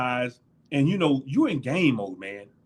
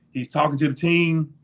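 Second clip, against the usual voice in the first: normal talking voice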